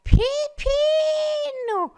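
one long high call. Retold or imitated. Mother on the ladder calling at a passing parakeet, long high call. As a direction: stretch the name out as she calls. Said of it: "ela grita".

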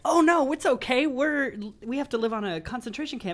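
high-pitched, hammed-up cheery movie caricature. Podcaster mocking how films show oppressed people, falsely cheery high voice. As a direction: sarcastically play the cheerfully oblivious sufferer. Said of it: "somebody in a movie like Mammy".

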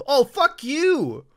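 slow, drawn-out exasperated groan of a curse; annoyed disbelief. Real, not acted. Realizing iFunny posts are ads, slow exasperated. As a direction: let the disgust stretch out.

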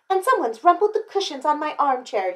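high, indignant complaint; storybook mom's outrage; yelling mother. A narrator's reading. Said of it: "yelled Missus Chan".

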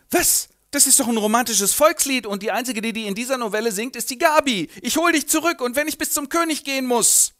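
high, loud agitated outburst. Acted. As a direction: blurt it out fired up. Renald recognizes Gabi's song.

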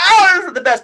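high, gushing delight; excited. Retold or imitated. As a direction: rave about it. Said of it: "you're like".

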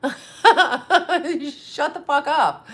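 drawn-out, laughing put-down; incredulous laugh in the voice; laughing dismissal. Real, not acted. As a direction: laugh through it, stretching the words out slowly. Laughing off a flatterer.

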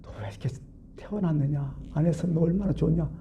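softly voiced sorrowful lament; retold heartache. Jesus pitying the disciple who will betray him, sorrowful. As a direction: lament it quietly, with pity. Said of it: "너무 안타까운 마음".

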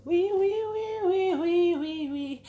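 high, loud chant of welcome; excited, rapid-fire yeses into a ringing call; excited singsong greeting. Real, not acted. Excited calling out in livestream greeting.

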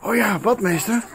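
usual speaking voice

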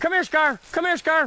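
high calling voice to dogs; yelling from the sidelines. Real, not acted. Calling dogs during the hunt.

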